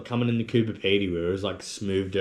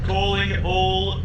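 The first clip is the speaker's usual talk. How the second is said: high, slow drawn-out call; duck call voice; 9 semitones higher, much slower than usual. Pretend. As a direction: stretch it out like calling ducks. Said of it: "Turn a bottle cap into a duck call".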